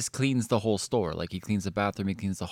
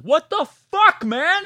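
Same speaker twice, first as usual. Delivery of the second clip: high, loud mock outburst; outraged yell; 9 dB louder, slower than usual. Retelling yelling at the cleaner, high and loud. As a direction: yell it, half laughing in outrage. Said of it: "I was like".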